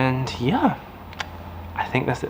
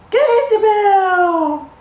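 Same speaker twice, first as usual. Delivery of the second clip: very high, slow, louder praise; ecstatic praise; 6 dB louder, much slower than usual. Real, not acted. Owner over the moon at puppy ringing bell, slow high squeal.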